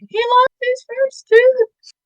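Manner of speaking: imitating herself sobbing out the news, high-pitched and slow; replaying her own tearful phone call, high and slow; retold crying. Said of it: "I called my grandma crying".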